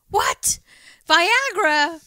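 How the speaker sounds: breathless startled what, then high loud Viagra; shocked discovery. Retold or imitated. Brother finding pills in dad's drawer, shocked. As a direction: gasp it out in disbelief.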